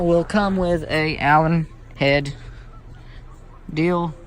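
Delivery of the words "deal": usual speaking voice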